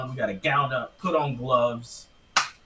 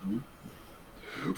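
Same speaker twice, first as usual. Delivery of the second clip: unvoiced scream-whisper of the title; whisper-scream it like death metal; whisper yelling; much slower than usual. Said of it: "it's clearly a whisper scream".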